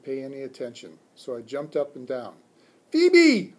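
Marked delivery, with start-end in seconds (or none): 2.9-3.5 very high, loud screamed name; high-pitched yell across the room. A narrator's reading. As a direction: scream her name to get noticed. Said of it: "I screamed".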